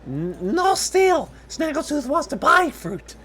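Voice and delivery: high squeaky kobold, slow stammered protest; very high little creature voice, taken aback; surprised kobold. Acted. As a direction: squeak it loudly, startled, like a small kobold. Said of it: "The kobold, visibly surprised by this".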